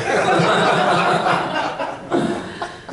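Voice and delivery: real long high laugh; laughter. Real, not acted. Preacher joking about needing a cameraman, laughing hard. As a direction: crack up helplessly. Cracks up over camera mishap.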